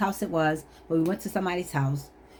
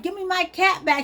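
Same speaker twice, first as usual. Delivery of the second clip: high pleading cat voice; high-pitched demanding animal character; mother cat; 9 semitones higher than usual. Retold or imitated. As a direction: talk as an upset mother cat. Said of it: "She was like".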